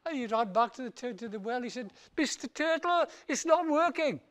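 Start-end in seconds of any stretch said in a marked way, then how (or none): 2.2-4.2 whiny Tumba. Acted. Tumba whining again.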